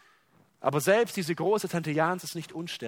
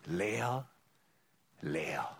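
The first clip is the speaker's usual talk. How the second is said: "Leer" soft and rising, then "leer" dropping low and faint; quiet sob; 8 semitones lower, 7 dB quieter than usual. A narrator's reading. Aunt weeping over worthless gifts, quiet sobbing. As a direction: sob the words out under the breath. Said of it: "schluchzte sie".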